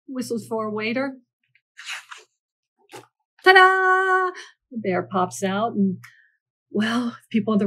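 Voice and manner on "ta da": loud, high magician's fanfare; ta-da reveal. A narrator's reading. Storyteller revealing the bear popping out, loud and bright. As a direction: announce it like the big trick.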